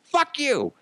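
hammed-up fury at a cartoon song; mock rage. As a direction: yell it like the soundtrack just blew your ears out. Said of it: "you're like".

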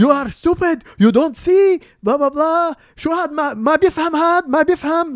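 loud, high mock tirade; imitated angry yelling. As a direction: imitate a man berating someone in two languages. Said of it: "yelling at him بالعربي and بالإنجليزي".

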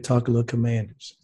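usual speaking voice

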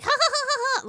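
high imitated ha ha ha; mock laugh. Imitating Michael laughing at his own joke, high ha ha. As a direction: ham up a creepy chuckle. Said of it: "laughing and shit".

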